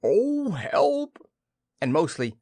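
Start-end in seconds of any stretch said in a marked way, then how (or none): 0.0-1.2 high, loud cry for help; high-pitched storybook wail; calling from inside a jar. Acted. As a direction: Pooh wailing with his head stuck in a jar. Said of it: "he said inside the jar".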